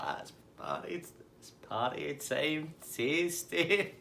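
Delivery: drawn-out party chant, rising from breath to high; Tasty Dave. Retold or imitated. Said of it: "He's like".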